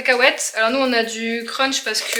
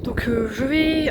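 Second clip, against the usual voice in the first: ordinary delivery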